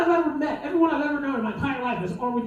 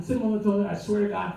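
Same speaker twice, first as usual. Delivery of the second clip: normal talking voice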